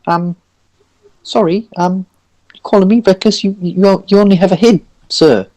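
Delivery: high, stammering polite protest; hesitant, affronted Orson. Acted. Golem answering being called reckless, high and timidly affronted. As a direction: object timidly in a high character voice. Game master plays Orson.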